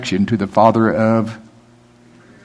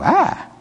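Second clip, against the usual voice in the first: high startled cry. Retold or imitated. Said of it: "I go".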